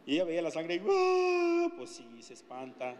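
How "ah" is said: sudden high shriek of fright, imitating the girl; frightened scream. Instructor imitating a terrified girl, high scream. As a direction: yelp like a scared girl seeing blood.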